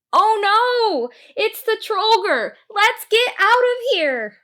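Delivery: very high, loud panicked scream; panicked shout. Acted. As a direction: scream at a friend to flee. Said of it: "and shouted".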